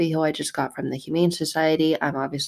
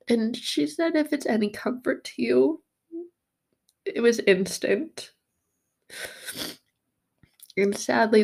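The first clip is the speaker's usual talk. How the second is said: tearful; 8 semitones higher than usual. Real, not acted. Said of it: "just tears in my eyes".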